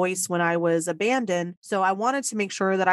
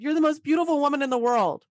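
higher, fast starstruck blurt; blurted awe; 6 semitones higher, faster than usual. Retold or imitated. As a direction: gush it out in one breath like an awestruck kid. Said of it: "I just spit it out".

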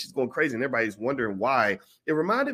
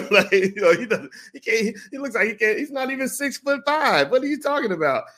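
mocking onlookers; 12 semitones higher, 3 dB louder than usual.

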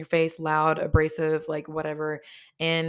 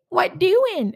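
high, loud silly pestering voice; mock pestering; 13 semitones higher, 9 dB louder than usual. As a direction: mimic a pest, pitched way up and loud. Mimics boyfriend's nagging silly question.

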